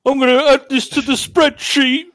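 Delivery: high loud petulant threat; squeaky falsetto mockery. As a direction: squeak it like a spoiled boss. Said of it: "you play Lewis".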